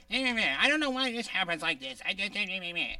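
fast babbling meh voice; silly meh voice. Shown as a demonstration. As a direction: do a goofy stammering meh voice. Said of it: "here are my voices. Ready?".

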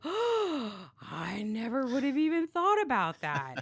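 play-acted wide-eyed wonder; mock amazement. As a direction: imitate someone floored by a revelation. Acts out amazed listeners after a gasp.

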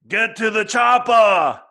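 slow, high, loud action-hero shout; Arnold Schwarzenegger impression. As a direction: bellow the order like an action hero. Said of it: "Arnold Schwarzenegger".

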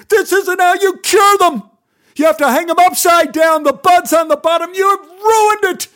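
high, loud enraged yelling; furious screaming; furious shout. Acted. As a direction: scream it at him, beside yourself. Mark screaming over ruined plants.

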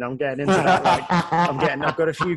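amused, laughing while admitting age. Real, not acted. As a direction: chuckle it out. Laughing about getting older.

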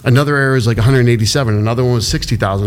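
normal talking voice

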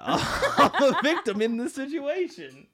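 high, loud, drawn-out laughing complaint; cracking up while playing wronged; laughing protest. Real, not acted. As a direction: protest helplessly through real laughter.

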